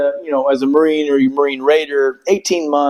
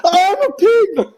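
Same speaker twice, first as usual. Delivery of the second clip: broken recruit. Retold or imitated. Sleepless recruit agreeing with the instructor in a very high laughing whimper. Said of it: "And you're like".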